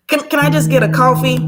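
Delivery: hurried awkward coffee order; flustered rushed request. Retold or imitated. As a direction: blurt it to escape the moment. Rushing past an awkward moment.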